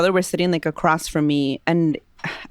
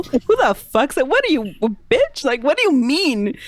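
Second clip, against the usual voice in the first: higher-pitched, cocky mock challenge; retold defiance; 7 semitones higher than usual. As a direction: taunt them, voice jumping up. Retelling mocking challenge to shadows.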